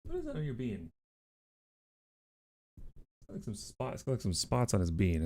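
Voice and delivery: very soft near-whisper; quietly peering at the pet's head; hushed. Real, not acted. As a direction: murmur close to the pet. Inspecting pet closely.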